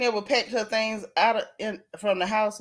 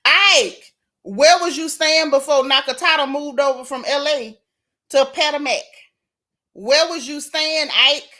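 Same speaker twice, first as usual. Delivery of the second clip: calling out; 3 semitones higher, 5 dB louder than usual. Real, not acted. Calling out Ike directly.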